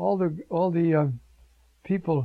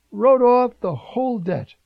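louder, proclaiming wrote off the whole debt; emphatic; 6 semitones higher, 7 dB louder than usual. Acted. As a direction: announce the release triumphantly. Repeating the climax.

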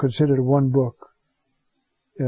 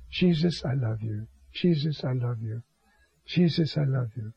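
soft, hushed loving prayer, much softer; tender prayer; 3 semitones lower, 7 dB quieter than usual. Retold or imitated. Priest praying tenderly to Jesus while falling asleep, hushed. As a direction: murmur it gently like a bedtime prayer.

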